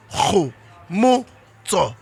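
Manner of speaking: Khomotso stretched out very slowly, breathy kh at the start; very slow name drill. Shown as a demonstration. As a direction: spell out Khomotso very slowly for a child. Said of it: "Say it again".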